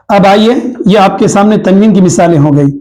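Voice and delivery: normal talking voice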